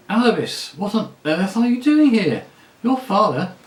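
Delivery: high, loud surprised cry across the street; loud outburst. Acted. La Saraline calls out to her old friend, loud and surprised. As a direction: blurt it out at the top of her voice. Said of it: "burst out at the top of her voice".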